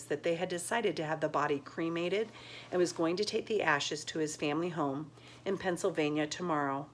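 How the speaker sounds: usual speaking voice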